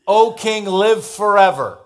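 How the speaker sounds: O king, live forever ringing out slowly; loud royal proclamation. A narrator's reading. Daniel's proclamation from the den.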